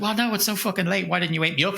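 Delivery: high, flustered complaint; panicked. Retold or imitated. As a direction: rush it like you're late. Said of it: "And she's like".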